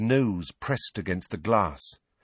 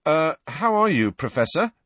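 higher, awkward greeting; slightly guilty; 7 semitones higher, 3 dB louder than usual. A narrator's reading. Boy greeting teacher he once harmed, slightly guilty. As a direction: greet him with a touch of guilt. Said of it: "sounding slightly guilty".

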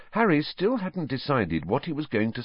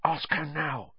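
hissed; 8 semitones higher, 5 dB quieter than usual. A narrator's reading. Ron urging Harry to ask the teacher, hissing. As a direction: hiss it softly so only he hears. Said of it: "Ron hissed at Harry".

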